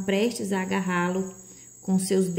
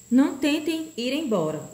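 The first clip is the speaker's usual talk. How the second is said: raised, threatening command; menacing order; 6 semitones higher than usual. A narrator's reading. The faceless man orders them not to leave, menacing. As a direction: order them to stay, higher-pitched. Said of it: "o sujeito ordenou com um gesto ameaçador".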